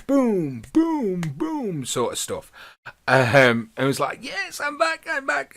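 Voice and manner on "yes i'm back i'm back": a much higher, hammed-up Jamaican accent; high, playful Jamaican accent; mock Jamaican accent. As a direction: imitate Kofi's Jamaican accent. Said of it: "puts on this Jamaican accent".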